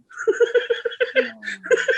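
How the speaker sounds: long high-pitched giggling fit; real high, wheezy laughter; laughing. Real, not acted. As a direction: crack up helplessly at a grim story. Cracks up at tragic story.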